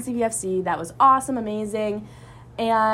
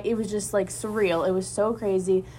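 ordinary talk, nothing marked

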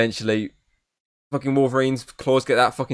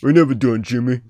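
Stallone impression; 3 semitones higher, 5 dB louder than usual. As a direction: lean into a mock Stallone growl. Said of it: "I did a Stallone sort of".

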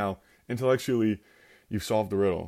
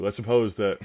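ordinary talk

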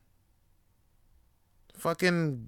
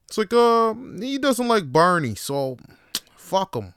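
put-on Boston accent; 7 semitones higher, 4 dB louder than usual. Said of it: "how to do a proper Boston accent".

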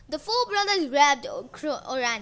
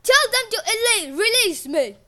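loud, high pleading shout; desperate shout; 8 semitones higher, 11 dB louder than usual. A narrator's reading. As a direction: yell for help. Uranus shouting, captured.

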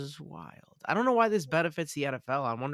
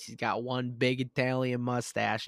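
ordinary talk, nothing marked

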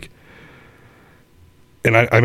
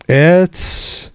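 slow proud answer; 6 semitones higher, much slower than usual. Retold or imitated. Student answers teacher.

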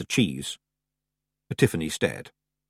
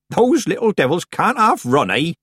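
high, loud, jovial country woman; Nanny Ogg; 10 semitones higher, 5 dB louder than usual. A narrator's reading. Old witch ambling in after the fleeing Feegles, loud jovial. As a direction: call out with cheeky amusement.

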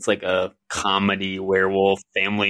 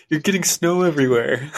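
put-on teasing boyfriend; 7 semitones higher, 3 dB louder than usual. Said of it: "He's like".

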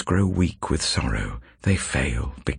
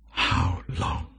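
whispered plea; 3 dB quieter than usual. Acted. Repeated lament, unvoiced.

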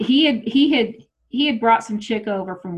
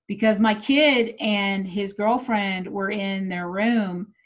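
ordinary talk, nothing marked

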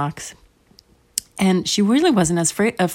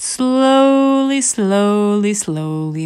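long stretched words, stepping down in pitch; drawn-out slowness; 4 semitones higher, 4 dB louder, much slower than usual. A narrator's reading. Teller showing the bug's crawl, slow drawling repetition. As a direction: make each word crawl, sinking gradually.

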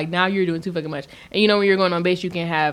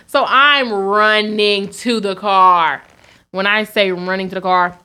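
normal talking voice

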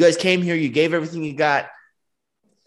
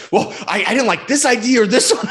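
higher, louder objection; louder pushback; 7 semitones higher, 5 dB louder than usual. Retold or imitated. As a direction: push back on the ideas eagerly. Manager now pushes back.

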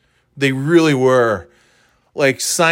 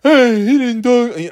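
high, slow, louder mock grumbler; complaining fans; 8 semitones higher, 5 dB louder, slower than usual. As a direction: mimic disgruntled fans as dopey moaners. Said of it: "these people are like".

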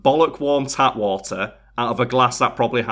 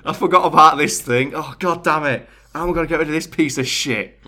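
higher, exasperated retold cursing; 4 semitones higher, 4 dB louder than usual. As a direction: act out cursing at an unwelcome discovery. Acting out finding the barricade.

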